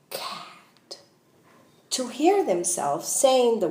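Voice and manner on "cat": soft unvoiced whisper. Shown as a demonstration. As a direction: breathe the word out silently. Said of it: "ask them to do it silently".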